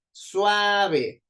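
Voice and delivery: suave with a high, drawn-out SUA; stress on SUA. Shown as a demonstration. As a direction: hold SUA in suave, drop on ve. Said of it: "Sua suena más fuerte". (suave alargando la SUA en tono agudo)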